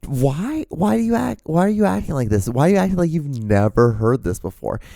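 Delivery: high-pitched, insistent disbelief; incredulous accusing. Retold or imitated. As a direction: imitate her pushy disbelief, starting high. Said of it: "it was just, like, how she was saying it".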